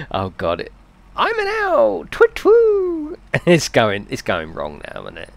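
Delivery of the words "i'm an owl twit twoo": high falsetto owl, louder; twit-twoo up high like an owl. Pretend. Streamer performing chat's owl message in owl voice. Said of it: "I'll do your impression of what I read".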